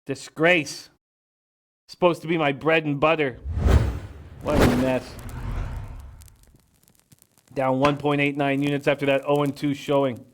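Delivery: gloomy, put-on low voice; mock gloom. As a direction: mourn the record like a funeral. Reading his bad hockey record.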